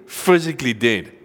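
ordinary talk, nothing marked

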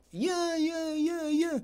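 high, soft babble of agreement; stoned old man. Retold or imitated.

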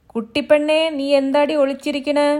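high, loud shout across the yard; loud calling. Acted. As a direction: call out to the hiding wife. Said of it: "ഉറക്കെ വിളിച്ചു ചോദിച്ചു".

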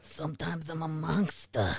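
a hint of a famous boxer's lisping growl; mild boxer impression; Mike Tyson. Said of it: "the only impression I can do of Mike Tyson".

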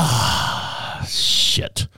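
soft unvoiced "Ah, shit"; whispered regret. Driver realizing he scared the mom, whispered regret. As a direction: breathe it out under your breath. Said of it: "And I kinda went".

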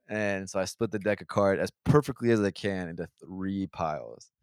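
normal talking voice

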